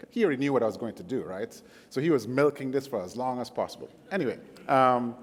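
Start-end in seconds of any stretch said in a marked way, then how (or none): none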